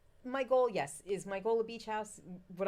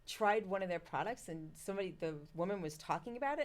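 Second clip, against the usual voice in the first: ordinary talk, nothing marked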